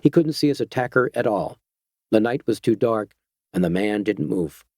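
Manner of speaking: ordinary delivery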